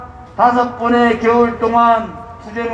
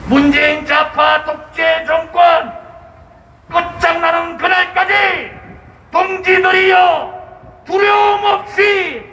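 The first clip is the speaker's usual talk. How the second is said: roaring rallying speech; 5 semitones higher than usual. Acted. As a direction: rouse the crowd like a rally leader. (군중을 선동하는 우렁찬 연설)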